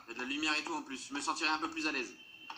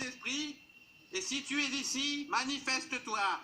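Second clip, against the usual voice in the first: solemn medium voice, slow and raised; seance medium; 6 semitones higher, slower than usual. Pretend. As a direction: summon the spirits grandly. Plays a medium invoking spirits.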